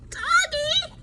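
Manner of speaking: high call across the yard; boy calling his runaway dog, high. Acted. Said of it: "called Froggy".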